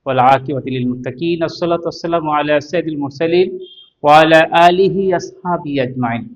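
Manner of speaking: slow, measured recitation of the opening prayer; formal Arabic invocation. Acted. Teacher opening the lesson to students with a slow recited blessing. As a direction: recite the blessing calmly before class.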